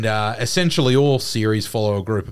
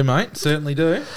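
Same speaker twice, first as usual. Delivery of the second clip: higher, broad Aussie bloke voice; aussie tradesman; 6 semitones higher than usual. Pretend. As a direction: lay on the ocker tradie twang. Mates bantering, repeated catchphrase.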